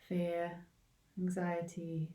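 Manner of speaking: ordinary talk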